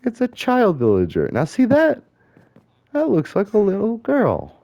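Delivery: high, squeaky remark on a sudden sighting; high-pitched exclamation. Real, not acted. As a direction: squeak it out on spotting something. Spots a child villager in game.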